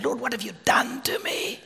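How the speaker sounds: imitating a hushed, helpless prayer; whispered plea. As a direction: whisper it to God, as if at a loss. Retold helpless prayer to God.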